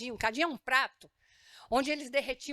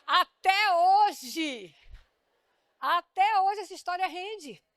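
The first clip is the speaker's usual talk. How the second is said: 1 loud amused emphasis; 6 semitones higher, 8 dB louder, much slower than usual. Real, not acted. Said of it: "laughing at the punchline".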